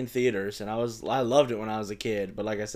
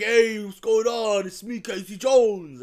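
higher, slow, louder mock New York accent; hammed-up New York cartoon accent, pitched higher; 10 semitones higher, 9 dB louder, slower than usual. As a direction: imitate a cheesy New York cartoon guy. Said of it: "he was like".

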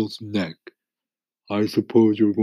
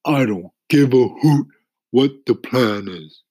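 crying out; 6 semitones higher, 8 dB louder than usual. A narrator's reading. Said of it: "cried the earthworm".

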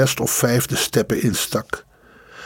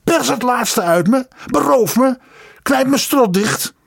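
high frantic shrieks, much higher; shrieking on; 12 semitones higher than usual. Acted. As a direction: keep screeching, out of control. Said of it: "krijschte hij".

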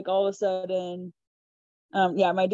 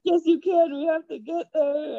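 retold urging; 8 semitones higher than usual. Retells helpers urging her on.

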